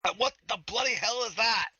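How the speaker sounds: shocked what-was-that; startled. Real, not acted. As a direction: jump at the strange cry. Hears inhuman voice under floor.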